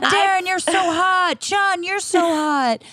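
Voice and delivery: gushing fan. Pretend.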